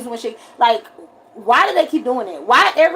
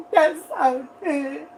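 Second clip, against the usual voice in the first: mock tearful gush; 5 dB quieter, much slower than usual. Imitating tearful viewer.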